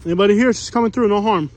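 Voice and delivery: loud call into the dark. Real, not acted. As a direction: call out to anyone hiding. Calling out into dark camp.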